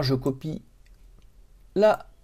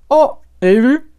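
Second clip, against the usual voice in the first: high, loud playful "oh!" of amazement; mock surprise; 8 semitones higher, 9 dB louder than usual. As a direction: act amazed at your own demo, pitched up and louder. Shows off the trick working. (étonnement joué)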